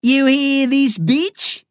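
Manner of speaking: high, loud mocking Australian outback accent; thick Australian outback accent. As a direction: ham up a broad Australian outback drawl for the crowd. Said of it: "He adopts a thick Australian outback accent".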